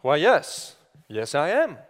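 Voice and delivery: dad hamming up royalty a little; a hint of grand kingly reply; playing the king. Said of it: "And so I walk in and say".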